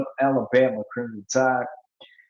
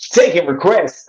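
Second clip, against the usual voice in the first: loud, higher announcer-style call; 5 semitones higher, 8 dB louder than usual. Pretend. Calls out to audience, DJ-like.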